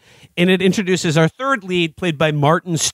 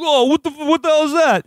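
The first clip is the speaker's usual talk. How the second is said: higher-pitched, startled tough-guy impression; Stallone; 9 semitones higher than usual. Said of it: "a lot of my Stallone voice".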